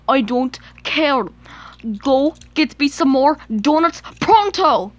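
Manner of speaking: loud, pushy command; spoiled engine snapping orders; bossy demand. Acted. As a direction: order them off rudely. Duncan orders more donuts.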